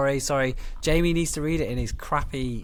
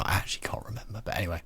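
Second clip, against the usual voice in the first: whisper; 3 semitones lower, 7 dB quieter, faster than usual. Real, not acted. Aside while telling date story.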